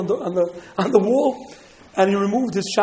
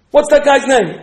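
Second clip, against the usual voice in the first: loud, higher soldier's demand; loud interrogation; 5 semitones higher, 12 dB louder than usual. Acted. Soldier questioning the householder about the man, demanding. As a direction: bark the question over the hushing.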